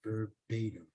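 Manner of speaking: low, quiet, understated sternness; stern. Retold or imitated. Script lady to actor about his lines, quietly stern. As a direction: imitate her quiet firm correction. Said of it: "she's very stern about it".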